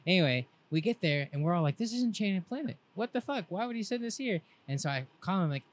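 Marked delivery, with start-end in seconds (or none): 1.8-4.4 baffled. Retold or imitated. Friends baffled at wrong address.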